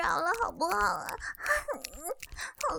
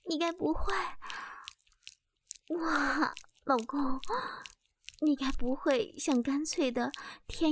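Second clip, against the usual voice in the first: hushed, teasing murmur, slow in places; teasing whisper; 4 semitones lower than usual. Acted. (贴耳挑逗的悄声)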